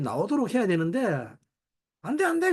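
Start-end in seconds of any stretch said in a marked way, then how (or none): 2.0-2.5 high-pitched mock protest; acted refusal. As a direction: act out a self-centred refusal, voice jumping up. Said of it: "그러죠, 나는". (높은 목소리로 거부하는 시늉)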